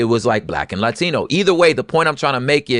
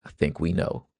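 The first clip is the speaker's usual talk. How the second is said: pointed quiet aside; 10 semitones lower, 9 dB quieter than usual. Real, not acted.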